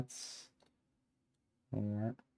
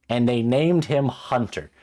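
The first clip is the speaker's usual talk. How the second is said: loud, higher, drawn-out complaint; appalled disbelief; 4 semitones higher, 7 dB louder, slower than usual. Real, not acted. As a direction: land the name loudly and slowly, appalled. Appalled at the character's name.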